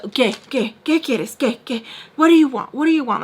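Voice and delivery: mock exasperated what do you want; rising annoyed demands from Mister Peach; annoyed boss. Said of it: "so annoyed with me".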